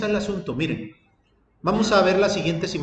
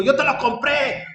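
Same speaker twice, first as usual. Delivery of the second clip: acted angry shout, higher in pitch; angry outburst; 6 semitones higher than usual. Husband grabbing the phone in an argument, acted angry shout. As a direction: shout it as an imitated furious husband. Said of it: "él se enojó, le agarró el teléfono y le dijo".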